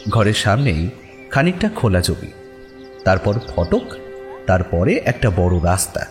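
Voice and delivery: plain reading voice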